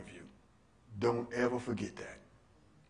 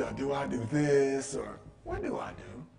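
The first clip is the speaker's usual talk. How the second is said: normal talking voice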